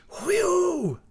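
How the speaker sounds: high, falling wind-like whoop; long, shrieking "whee-oo"; old snow woman's howl. A narrator's reading. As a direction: howl like a winter gale.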